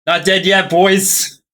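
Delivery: loud, high triumphant yell; defiant shout. Real, not acted. Player survives at zero health.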